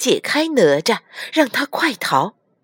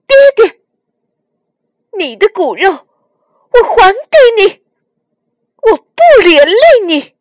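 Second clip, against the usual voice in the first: put-on high, loud, tearful boy's voice; anguished outcry; 12 semitones higher, 11 dB louder than usual. A narrator's reading. Nezha renounces his body before father, crying out. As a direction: cry out desperately at full force.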